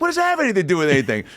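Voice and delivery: high, fast exasperated Larry; fast high-pitched Larry complaint; Larry David impression. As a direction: rattle off the outrage quickly. Retelling Larry David's retort.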